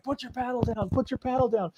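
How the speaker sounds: high-pitched mock yell, kept down in volume; retold yelling. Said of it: "Jerry's there yelling at them".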